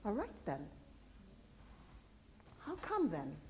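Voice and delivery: very soft, understated reply; accepting listeners. Acted. Others answering the believer's claim, softly accepting. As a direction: voice a quietly intrigued listener. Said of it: "And they will say".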